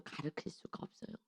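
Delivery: hushed, breathless whisper; preacher's whispered point. Real, not acted. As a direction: drop to a whisper for weight.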